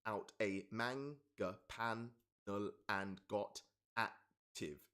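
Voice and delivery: very slow, each syllable spaced out; slow syllable-by-syllable read; counting syllables slowly. Shown as a demonstration. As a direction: tap out every syllable of the line. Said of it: "Fifteen syllables".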